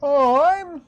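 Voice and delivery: high-voiced old rabbit priest greeting survivors; old rabbit folk. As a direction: pitch way up for a doddery old rabbit.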